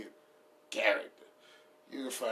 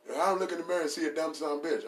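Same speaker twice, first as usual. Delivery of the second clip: fast, raised bragging voice; self-hyping author; 6 semitones higher, faster than usual. Retold or imitated. Reading a celebrity book in the author's voice, fast brag. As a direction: rattle off the brag.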